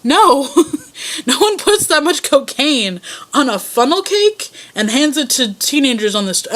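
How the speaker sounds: high, laughing disbelief; incredulous laughing retort. Retold or imitated. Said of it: "we all just kinda looked at him like".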